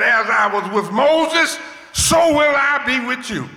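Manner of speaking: the Lord's promise shouted to the congregation; preaching proclamation. Retold or imitated. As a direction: proclaim it like a preacher at full force.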